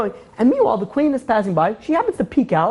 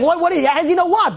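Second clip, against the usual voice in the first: high-pitched, stammered disbelief; astonished demand; 9 semitones higher than usual. Retold or imitated. King to his mother, astonished demand. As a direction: blurt the question out, much higher.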